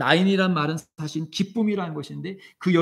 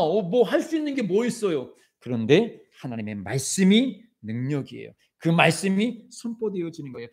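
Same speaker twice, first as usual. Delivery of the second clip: impassioned sermon, rising and falling; preaching; 3 semitones higher, 4 dB louder than usual. Acted. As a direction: preach it with fervor to the congregation. (열정적인 설교)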